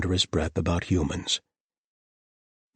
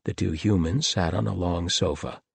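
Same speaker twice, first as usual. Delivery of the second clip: ordinary delivery, nothing marked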